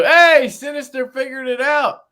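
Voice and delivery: high, booming shout-out; excited shout. Real, not acted. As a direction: holler it out in celebration. Calling out to a viewer.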